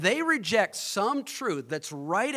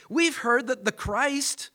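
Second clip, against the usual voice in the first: high, slow, insistent objection; unbelieving; 7 semitones higher, slower than usual. Acted. Crowd answering Jesus from the law, unbelieving. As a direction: push back slowly in a raised pitch. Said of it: "the tone is unbelief".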